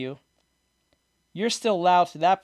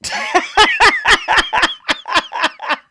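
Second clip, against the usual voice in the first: high, loud burst of laughter; loud real laughter; 14 semitones higher, 7 dB louder than usual. Real, not acted. Cracking up at his own joke to the friend, loud real laughter.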